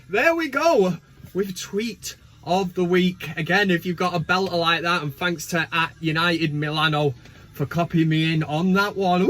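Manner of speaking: ordinary talk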